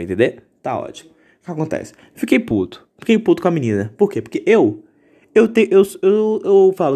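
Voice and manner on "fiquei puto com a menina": ordinary talk, nothing marked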